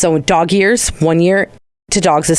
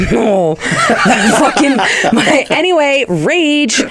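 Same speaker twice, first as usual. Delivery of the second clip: high, laughing exclamation, slow start; laughing outburst; 8 semitones higher, much slower than usual. Real, not acted. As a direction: burst out laughing at the memory. Laughing off her own anger.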